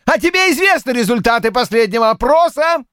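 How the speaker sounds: high, eager cutting-in question; interrupting eagerly. A narrator's reading. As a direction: jump in over him. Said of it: "перебил Полвард".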